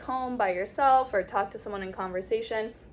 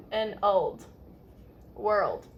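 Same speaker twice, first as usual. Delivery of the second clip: ordinary talk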